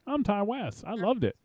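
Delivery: high, thin mock old man voice; a squeaky caricature of the director; Ty West impression. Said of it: "his Ty West impersonation".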